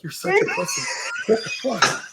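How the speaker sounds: strained high shout held down in volume; mock self-berating. Back at the bathroom mirror, scolding himself in disbelief. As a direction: squeeze out a comic yell at yourself.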